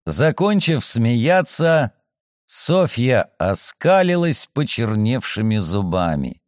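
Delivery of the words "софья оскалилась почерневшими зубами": ordinary delivery, nothing marked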